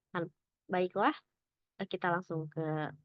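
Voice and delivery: usual speaking voice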